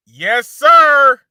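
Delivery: high, loud hype shout; excited shout. Real, not acted. Vlogger cheering a hot sneaker release, loud excited shout. Hyped reaction to a sneaker drop.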